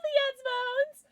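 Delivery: high, loud call of a name. Real, not acted. Streamer leads chat in greeting someone, calling out. Everyone told to say hi.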